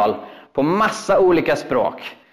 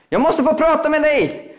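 urgent plea; 13 semitones higher than usual. Retold or imitated.

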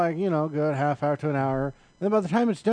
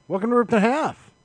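show intro; 9 semitones higher, 4 dB louder than usual. Pretend. Mock show intro, restarted.

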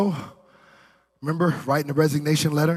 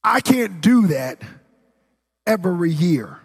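higher, forceful pulpit delivery; emphatic preaching; 5 semitones higher, 5 dB louder than usual. Real, not acted. Preacher to congregation on costly sacrificial giving, emphatic preaching. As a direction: drive each phrase home to the congregation.

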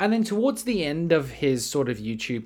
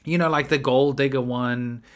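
ordinary talk, nothing marked

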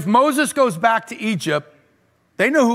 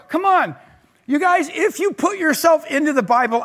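excited urging; 7 semitones higher than usual. Retold or imitated. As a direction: pitch up, pleading with the kids. Pastor urging youth group.

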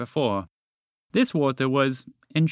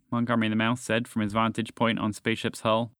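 ordinary delivery

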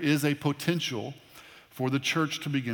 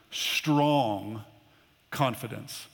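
"strong confidence" said lower and drawn out, leaning on "strong"; stress on strong confidence; 3 semitones lower, much slower than usual. Shown as a demonstration. Pastor reading Proverbs, emphasizing "strong confidence". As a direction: lean on the words "strong confidence". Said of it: "I want to emphasize this word".

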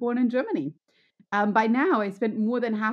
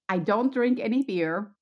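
ordinary delivery

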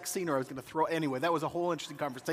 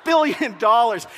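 exasperated; 5 semitones higher, 6 dB louder than usual. Retold or imitated. Audience scolding the old woman for throwing the diamond, exasperated. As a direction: scold the screen in disbelief. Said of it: "Oh, she throws it".

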